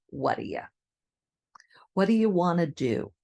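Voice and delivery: "What do you" run together as "whaddaya"; reduced pronunciation. Shown as a demonstration. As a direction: squish "What do you" into one quick word. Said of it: "has a very common reduction".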